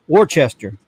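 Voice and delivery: Worcester with a ch, said out proudly; dad's version. Retold or imitated. Dad the truck driver answering the locals. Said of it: "He says".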